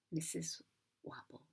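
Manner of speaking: plain reading voice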